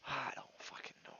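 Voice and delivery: despairing whisper. Real, not acted. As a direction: let it slip out, hopeless. Despairing mutter to self.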